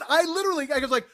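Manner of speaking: normal talking voice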